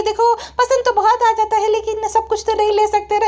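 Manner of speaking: high, whiny woman's voice; भालू. Retold or imitated. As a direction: mimic a wife refusing in a high voice. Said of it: "तो भालू कहती है". (ऊँची आवाज़ में पत्नी की नकल)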